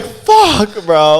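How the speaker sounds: high, loud mock dismay; drawn-out exasperated exclamation; mortified groaned curse. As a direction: re-enact realising the blunder, laughter near. Retold dismay on realising the blunder.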